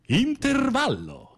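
very high, slow sing-song announcement; a drawn-out falsetto "intervallo"; intermission call. Acted.

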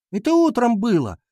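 put-on high girlish indignation; indignant. A narrator's reading. Girl rebutting boy's excuse, high indignation. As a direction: snap back up high. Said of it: "возмутилась Дана".

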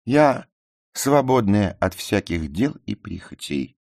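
high, loud haughty declaration; proud. A narrator's reading. Ruth declares her freedom to the narrator, proud. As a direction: announce it with lofty pride. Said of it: "гордо сказала она".

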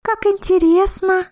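high, slow amazed squeak; surprised. A narrator's reading. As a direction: squeal it in delighted wonder. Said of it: "удивлённо посмотрела".

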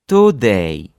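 "today" loud, with long full vowels; emphatic, stretched "today"; lengthened Russian vowels. Shown as a demonstration. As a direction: draw out both vowels of "today", Russian-style. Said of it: "and Russian version".

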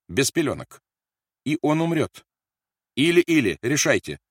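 high, loud, in short bursts; menacing ultimatum. A narrator's reading. Officer gives the mother an ultimatum, menacing. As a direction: force her to choose.

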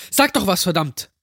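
high-pitched, urgent plea, dropping on the curse; desperate demand. Acted. Jack begs the silent line for an answer, desperate.

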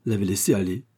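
usual speaking voice

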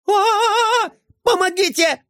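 high shrill shriek for help. A narrator's reading. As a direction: squeal in terror. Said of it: "заверещал он".

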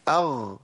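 أغ with a voiced gh, held only briefly; still ghayn. Shown as a demonstration. Teacher showing how long to hold it, carefully measured. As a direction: close on a measured voiced gh in أغ, no extra length. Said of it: "ما تزيدش عن كده".

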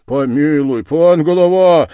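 loud, rising appeal; pleading cry. Acted. Villagers beg the village head, loud pleading cry. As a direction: cry out begging for mercy. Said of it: "закричали некоторые, кланяясь в ноги".

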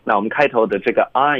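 ordinary talk, nothing marked

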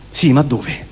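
usual speaking voice

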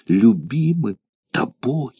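lingering joy. A narrator's reading. The gladiator repeats it to himself, slow and savouring. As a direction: draw it out as if savouring it. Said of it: "в невыразимом порыве радости".

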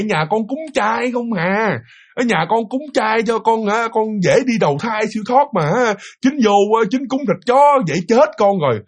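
high, pleading ghost complaint, partly slowed; a dead niece's high, reproachful plea; ghost niece scolding. Retold or imitated. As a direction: whine at your uncle from beyond the grave. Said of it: "bà hiện giờ bà chửi".

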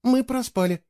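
small, high-pitched admission; timid confession. A narrator's reading. Girl admitting to the boy they overslept, timid. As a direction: own up sheepishly. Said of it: "робко призналась она".

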